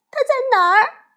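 put-on high, tearful little girl's cry; a mild hint of tears in a child's plea; crying sadly. 小熊妹妹问妈妈金鱼在哪，带着哭腔. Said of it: "小熊妹妹哭得很伤心".

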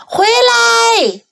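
high, drawn call. A narrator's reading. Old woman calls the runaway back, very high cry. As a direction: call after him very high. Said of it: "小老太婆叫道".

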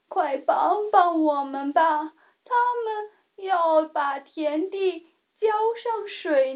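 plead, a hint of tears; sad plea. A narrator's reading. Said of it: "伤心地说道". (略带伤心的恳求)

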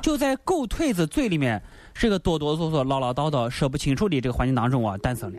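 dropping low and fading as the plot is born; storyteller narration. A narrator's reading. Storyteller sinking low to tell the scheme's birth. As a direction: let the tale sink down to a hush.

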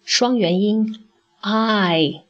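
双元音I stretched slowly; teacher stressing the diphthong slowly; slow emphasis. Shown as a demonstration. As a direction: dwell on 双元音I. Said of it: "我们首先要正确地读出".

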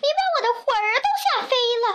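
put-on high, fast, startled old woman; acted scared squeal at a rapid pace; frightened old woman's cry. A narrator's reading. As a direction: blurt it out high and panicky. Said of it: "瘦小的老妇人喊起来".

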